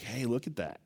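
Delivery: sly serpent coaxing, ending in a whisper; tempting serpent. Retold or imitated. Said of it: "He's like".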